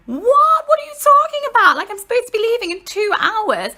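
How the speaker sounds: high, loud retold outburst; shocked disbelief. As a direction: cry out in mock-horrified disbelief, easing towards the end. Disbelief at cancelled flight.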